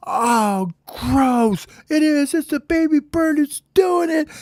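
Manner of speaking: very high falsetto cry of disgust; squealed outrage. Acted. All the birds catch baby bird at it, squealing in disgust. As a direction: squeal and point out the culprit.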